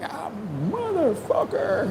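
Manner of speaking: drawn-out, high exasperated curse; frustrated outburst. Retold or imitated. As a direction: let the curse spill out in frustration. Retold angry outburst while editing.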